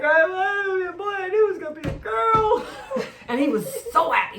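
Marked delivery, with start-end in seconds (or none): 0.0-2.7 high, loud crying wail; mock crying wail. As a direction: wail it like a heartbroken kid. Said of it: "I was like".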